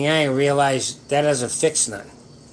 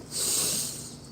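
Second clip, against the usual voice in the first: deep breath demo; 11 dB quieter than usual. Shown as a demonstration. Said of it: "start deep breathing".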